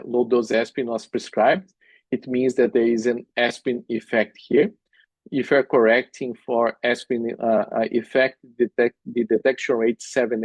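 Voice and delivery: ordinary delivery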